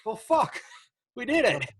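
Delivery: mock triumphant announcement. Mock news of covid ban.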